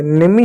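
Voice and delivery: नेमी with the final e sounded as long "ee"; wrong reading. Shown as a demonstration. Teacher asking whether e is read, नेमी with wrong ee ending. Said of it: "बड़ी की मात्रा लगा दें".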